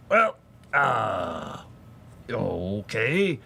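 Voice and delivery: raspy character imitation, drawn out; Beetlejuice. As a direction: croak it like a movie ghoul, imitating. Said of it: "talking to him like Beetlejuice".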